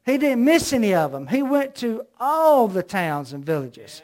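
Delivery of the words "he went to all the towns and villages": all leaned on, slow and deliberate; stress on all. Real, not acted. Preacher to congregation, hammering the word "all". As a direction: press hard on the word "all". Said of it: "That word "all" is important".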